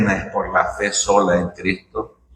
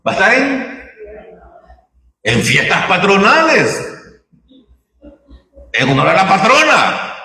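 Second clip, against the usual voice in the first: higher, pressing sermon voice; preaching at full force; 8 semitones higher than usual. Acted. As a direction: preach it out, urging the congregation. Predica con fuerza. (predicación intensa, voz alta y enfática)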